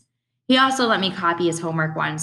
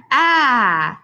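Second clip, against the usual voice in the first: loud, higher mock scream; demonstrated cry; 6 semitones higher, 6 dB louder than usual. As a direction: yelp it to show what it was like. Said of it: "It was like a".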